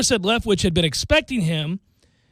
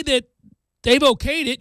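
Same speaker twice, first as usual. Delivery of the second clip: ordinary delivery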